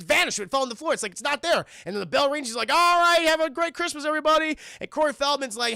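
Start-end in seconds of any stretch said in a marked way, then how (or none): 2.7-4.6 higher, raised teacher's send-off; acted cheery dismissal, pitched up; calling out. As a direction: call it out to the whole class. Acting teacher dismissing class.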